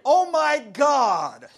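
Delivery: slow stretched-out oh my god; drawn-out mock disbelief; mock astonished. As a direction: act stunned by the headlines. Mock awe at the news.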